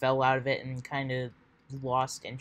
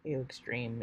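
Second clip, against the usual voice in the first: ordinary delivery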